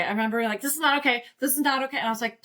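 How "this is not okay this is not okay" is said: retold alarm, pitched up; higher, urgent reported protest; friend freaking out. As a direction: imitate a freaked-out friend insisting. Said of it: "she just freaked out".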